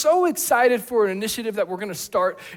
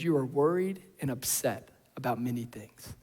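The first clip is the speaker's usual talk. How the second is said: much softer, lower, gentle words; tender rebuke; 9 semitones lower, 10 dB quieter than usual. Acted. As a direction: soothe her worry. Jesus speaking softly.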